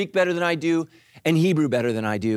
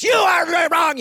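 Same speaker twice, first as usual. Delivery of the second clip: loud, high mock German robot; hammed-up German navigation voice; German car voice; 12 semitones higher, 6 dB louder, slower than usual. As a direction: bark it like a stern German computer. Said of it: "I don't want a German voice".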